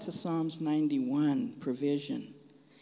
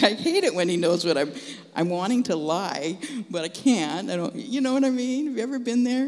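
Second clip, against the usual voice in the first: louder and higher, tumbling out fast; excited outburst; 4 semitones higher, 5 dB louder than usual. Real, not acted. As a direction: burst out with rising excitement to the crowd. Excited confession to the congregation.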